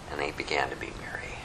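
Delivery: hushed whispered line. Shown as a demonstration. Preacher whispering the joyful ending to the congregation. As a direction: breathe it out secretly.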